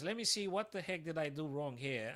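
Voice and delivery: ordinary delivery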